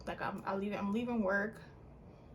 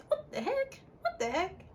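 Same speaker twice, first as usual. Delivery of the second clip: disbelief; 12 semitones higher, slower than usual. Retold or imitated. Finding card after scam.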